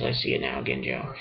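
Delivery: very low, hushed character imitation. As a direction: drop it right down, deep and soft. Repeats the line in character's voice.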